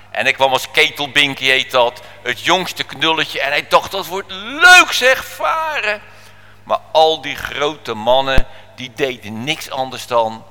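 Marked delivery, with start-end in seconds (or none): 3.9-6.0 high, drawn-out excitement; gleeful anticipation. Acted. Boy looking forward to going to sea, gleeful. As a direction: relish it, slow and rising high.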